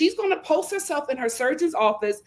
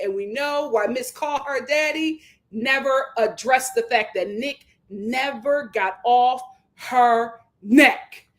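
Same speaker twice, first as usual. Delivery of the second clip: ordinary talk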